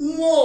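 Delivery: teacher models উও for students, clearly; nasal vowel demo. Shown as a demonstration. Teacher shows the class how উও is said, slow and clear. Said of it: "এটা উচ্চারণ হবে".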